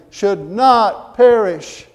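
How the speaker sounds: "not" drawn out loud and high, the whole phrase slow; emphatic NOT. Real, not acted. Preacher tells the congregation what God provided, emphatic "not". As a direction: lean hard and long on "not" in "should not perish".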